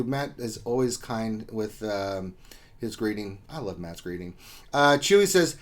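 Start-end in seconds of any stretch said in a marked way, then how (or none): none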